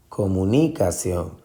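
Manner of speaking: comunicación with NI punched, comu-NI-cación; stress on third syllable. Shown as a demonstration. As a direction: hit the ni in the middle of comunicación. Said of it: "si usted se va a buscar". (comunicación con fuerza en la sílaba NI)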